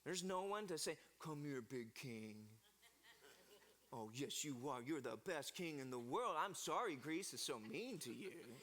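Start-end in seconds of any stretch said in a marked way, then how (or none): 1.2-8.6 cooing comfort. Pretend. A wife comforting the beaten king, soft low cooing. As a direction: coo to him like a hurt child.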